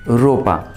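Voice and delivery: ropa said slowly and clearly, rolled r; careful teacher model of रोपा; slow Spanish model word. Shown as a demonstration. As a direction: repeat रोपा carefully for the learner. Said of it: "clothes को Spanish में बोला जाता है रोपा".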